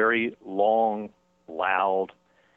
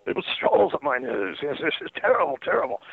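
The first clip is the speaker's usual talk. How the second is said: higher, hammed-up aggrieved whine; milk the misery for laughs; mock complaint; 8 semitones higher than usual. Host mimicking Rains' grievance, hammed-up whine.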